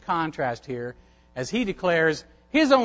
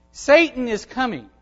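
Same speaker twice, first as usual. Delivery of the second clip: normal talking voice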